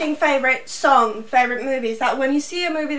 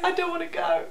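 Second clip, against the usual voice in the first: higher, laughing echo of a plea; mock plea echo; 5 semitones higher, 4 dB quieter than usual. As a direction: echo the quote high, half laughing. Echoes the quote more softly.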